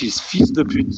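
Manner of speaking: the French version, lightly; French pronunciation. Shown as a demonstration. Player gives the French version of the insult. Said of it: "In French".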